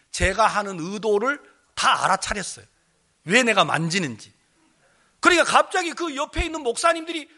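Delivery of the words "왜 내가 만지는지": ordinary delivery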